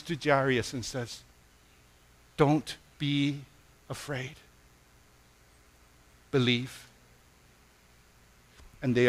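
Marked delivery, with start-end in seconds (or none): 2.4-6.8 a touch of hushed comfort, dropping quiet; gently steady a frightened father; soft reassurance. Acted. Jesus telling the grieving father not to fear, softly.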